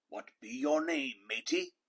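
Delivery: pirate question rising high on matey; pirate asking. A narrator's reading. Head pirate asking the boy his name. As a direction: ask like a curious captain. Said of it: "the head pirate asked".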